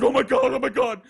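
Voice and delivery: high, loud mock-panicked cry; imitated panic. Woman learning her boyfriend is downstairs, imitated high panic. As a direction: panic like someone about to be caught.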